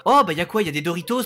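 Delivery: normal talking voice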